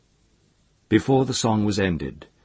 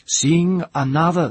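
high, loud chief's order; louder command; 10 semitones higher, 6 dB louder than usual. A narrator's reading.

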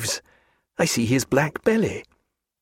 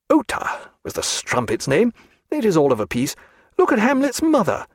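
high, swooping outrage without shouting; scandalised character voice, pitched up; indignant tirade; 6 semitones higher than usual. A narrator's reading. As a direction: rail at the culprits, pitch leaping on key words. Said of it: "glaring angrily at Elsinore".

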